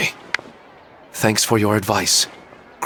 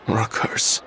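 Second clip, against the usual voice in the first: breathed out almost unvoiced, softer; whispered aside; 5 dB quieter than usual. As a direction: let the last words fall into a whisper. Troubled husband doubts his dreams.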